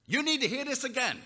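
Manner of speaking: high, loud sarcastic jab; mock them for wanting it again; prickly sarcasm. Healed man sneering at the Pharisees, high and sarcastic. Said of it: "he was prickly".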